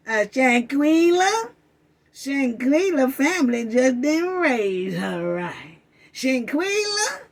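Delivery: slow, drawn-out mocking drawl; mock slow talker. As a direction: imitate her slow talk, rising questioningly on the name. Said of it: "Talking slow".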